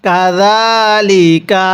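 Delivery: "কাযালিকা" very slow, za held one alif, ka short; stretched za. Shown as a demonstration. Teacher shows the stretch on za, not on ka. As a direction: hold the middle vowel of "কাযালিকা". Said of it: "তাহলে কিভাবে পড়ব?".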